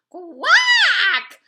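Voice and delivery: loud, high, falling quack; one sharp frightened quack; startled duck quack. A narrator's reading. Mother duck knocked back by cars, loud startled quack. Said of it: "went Missus Mallard as she tumbled".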